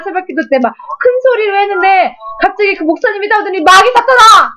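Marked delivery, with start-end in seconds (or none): 3.6-4.5 loud, high rebuke at the devil; pastor's shout. Retold or imitated. Pastor chasing her out of the church, loud, high shout. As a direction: bellow it like an exorcism.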